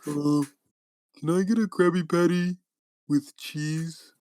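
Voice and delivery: a lightly voiced dopey Patrick. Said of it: "he's like".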